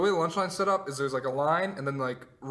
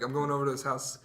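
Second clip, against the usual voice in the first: ordinary talk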